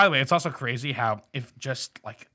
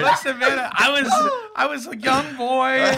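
much higher and louder, whiny laughing plea; laughing protest; 13 semitones higher, 7 dB louder than usual. Real, not acted. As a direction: plead your case through laughter. Defends childhood self, laughing.

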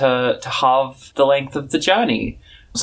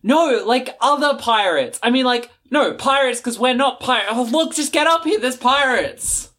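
higher, fumbling and flustered; flustered lookout; 10 semitones higher than usual. Acted. Eagle Eye corrects Old Smoky, higher flustered fumbling. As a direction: stammer through it, getting impatient.

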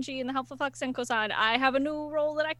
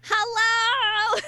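high, loud, drawn-out hello; excited greeting; 9 semitones higher, 6 dB louder than usual. Real, not acted. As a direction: greet them with a big excited burst. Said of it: "I am so excited".

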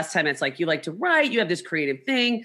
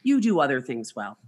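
dry consolation; 7 semitones lower, 3 dB quieter than usual. Retold or imitated. Deadpan reply to clueless husband.

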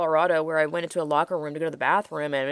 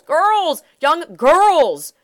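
loud indignant outburst, pitched higher; real furious cry, louder and higher; outraged; 9 semitones higher, 8 dB louder, much slower than usual. Real, not acted. Outraged rant about girls' safety.

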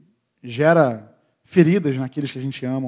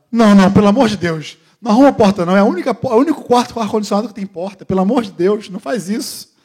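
pleading high and loud; desesperada implorando; 6 semitones higher, 5 dB louder than usual. Retold or imitated. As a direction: mimic the wife begging him to stop. Esposa implorando pela porta. (imitando a esposa implorando, aguda e alta)

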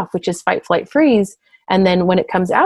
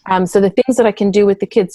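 normal talking voice

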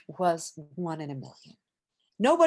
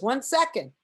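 higher-pitched call through a door; mildly raised, imitated stalling shout; retold stalling call; 7 semitones higher, 4 dB louder than usual. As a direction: call out to hold off the visitor. George stalls behind the door.

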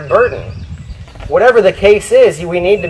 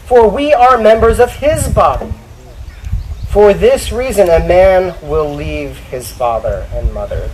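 Scripture reading; 5 semitones higher than usual. Acted. Preacher reads husbands their duty, emphatic Scripture reading. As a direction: proclaim the verse to the congregation.

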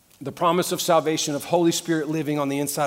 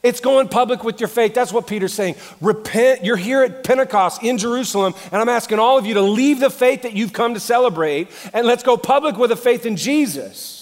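loud, higher-pitched pulpit preaching; raised voice, driving the point home; full-force preaching; 8 semitones higher, 9 dB louder than usual. Acted. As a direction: preach it hard to a big congregation.

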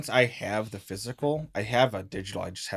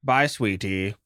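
tender auntie voice. Acted. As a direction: say it like a loving old aunt. Aunt farewell to goblin.

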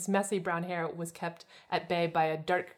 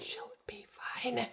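slow breathy whisper; 3 semitones higher, 10 dB quieter, much slower than usual. Acted. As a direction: whisper it slowly. Whispered repeat of same line.

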